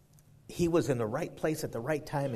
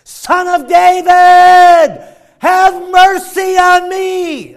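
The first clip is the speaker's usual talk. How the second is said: long, drawn-out shout at full force; desperate cry out; 22 dB louder, much slower than usual. Shown as a demonstration. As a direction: scream it high and slow across a crowd. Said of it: "this is how he would say it".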